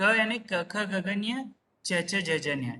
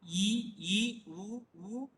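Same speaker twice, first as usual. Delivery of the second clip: vowel list recitation; much slower than usual. Shown as a demonstration. Teacher showing all the matras on the chart, measured recitation. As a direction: list each vowel sound separately with rising tunes. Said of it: "यहाँ पर सभी देख सकते हैं".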